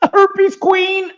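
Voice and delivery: high mocking singsong jab; a jeering high-pitched taunt. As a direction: tease it high and loud. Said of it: "I've never been like".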